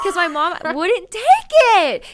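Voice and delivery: high, loud, drawn-out complaint; exasperated protest. Real, not acted. Roommate explaining why she carried everything, loudly exasperated. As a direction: wail it over the laughter. Mock complaint about mom over laughter.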